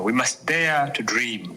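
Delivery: ordinary talk, nothing marked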